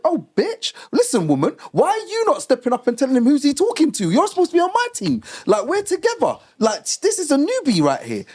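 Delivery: worked-up retelling, voice climbing high; heated mock rant. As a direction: let rip like a wronged teen. Ranting at mum, high.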